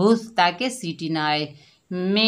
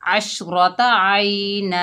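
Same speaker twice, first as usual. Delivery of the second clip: slow recitation stopping on a long a; 4 dB louder, much slower than usual. Shown as a demonstration. Teacher showing the pause with alif, slow chanted. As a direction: chant slowly, ending आइना on a drawn-out a. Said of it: "Waqf बिल इब्दाल".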